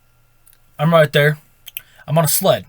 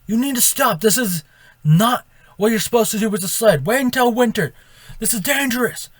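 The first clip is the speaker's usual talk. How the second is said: mock cop yelling; 7 semitones higher than usual. Cop behind the sled scolding the kids, mock high yell. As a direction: play the cop half-yelling a warning from behind, raised pitch. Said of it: "and yelling at us".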